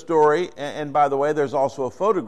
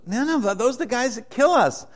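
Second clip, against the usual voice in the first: higher, heated voice of a resentful Jonah; indignant Jonah; 4 semitones higher than usual. Retold or imitated. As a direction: protest like a man who won't go. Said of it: "And he goes".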